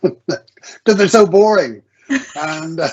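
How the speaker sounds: amused laugh through the words, slow and high. Real, not acted. Author laughing to interviewer about boring manuals, amused laugh. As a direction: laugh it off, drawn out.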